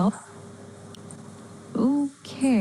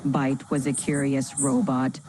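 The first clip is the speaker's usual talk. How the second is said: low, even, robotic storyteller; dramatic robotic voice; 7 semitones lower than usual. Acted. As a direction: read it like a dramatic machine. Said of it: "Initiating dramatic robotic voice".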